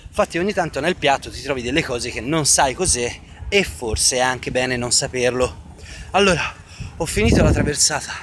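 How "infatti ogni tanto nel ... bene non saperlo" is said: ordinary talk, nothing marked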